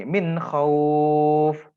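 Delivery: khauf with a throaty kh and held au; recitation example. Shown as a demonstration. Teacher reciting an example for students, measured. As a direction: recite min khauf like the Quran. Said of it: "Contoh dari sini".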